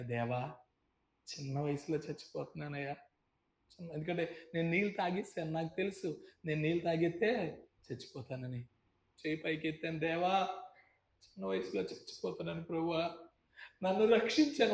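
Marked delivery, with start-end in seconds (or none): 0.0-3.9 faint drowning prayer. Retold or imitated. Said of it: "నా చేయి పైకెత్తాను". 10.0-14.7 desperate prayer. Retold or imitated. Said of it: "ఒక్క మాట ప్రార్థన చేసి".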